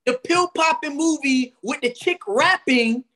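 high-pitched disbelieving challenge; incredulous. Real, not acted. Arguing with friend who liked the movie, incredulous. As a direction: question it in rising, incredulous disbelief. Disputing that the movie was good.

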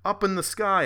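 high, loud heroic call pointing skyward; narrator yelling. Retold or imitated. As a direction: shout it out like a stage announcer.

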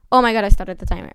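fast, loud startled outburst; surprised. Real, not acted. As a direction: blurt it out, caught off guard. Startled that the timer began.

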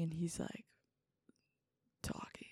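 hushed, whispered innuendo. Real, not acted. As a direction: whisper it knowingly.

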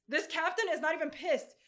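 ordinary talk

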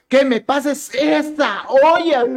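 high, loud, drawn-out mock yell; retold shout. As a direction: imitate an exasperated mother-in-law yelling, slowly. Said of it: "tu mamá me gritó". (grito imitado de suegra, agudo y alargado)

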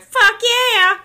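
high, loud imitated fan holler; full-voiced mock cheer; hella loud. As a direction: yell it like a rowdy wrestling fan. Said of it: "hella loud".

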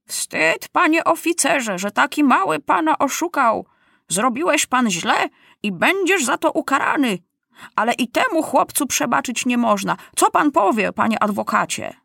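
put-on high, declaiming old judge; judge general rebuking. A narrator's reading. Judge shaming the officer before court, stern rebuke. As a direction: scold from the bench, pitched high.

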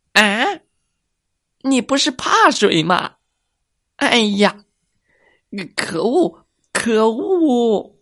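high, exasperated fox cry; frustrated fox. Acted. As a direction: wail in defeat as the fox.

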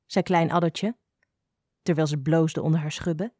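usual speaking voice

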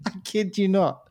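giggling repeat; laughing. Real, not acted. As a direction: laugh it out again.

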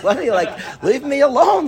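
high-pitched, fed-up plea; retold exasperation. Student to his nagging study partner, exasperated. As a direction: act out brushing off a pestering friend.

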